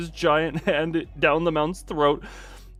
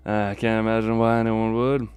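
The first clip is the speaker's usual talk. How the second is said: lazy Southern twang, lower voice; Southern accent; 4 semitones lower than usual. Acted. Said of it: "Southern accent".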